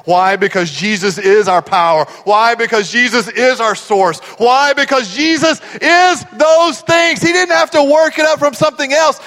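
loud, high, climbing preacher's cry; full-force preaching. Real, not acted. Preacher declaring what Jesus is, full-force and rising. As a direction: build to a fervent, shouted climax.

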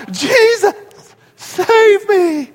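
high, stretched-out cry for rescue; mock desperate cry. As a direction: wail it high, in mock panic. Reprises the panicked bungee cry.

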